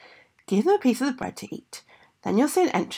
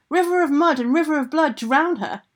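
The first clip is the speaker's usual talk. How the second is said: higher, louder storybook order; commanding shout; 6 semitones higher, 5 dB louder than usual. Acted. Ogress orders river to drown girl, commanding shout. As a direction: call out the command across a distance.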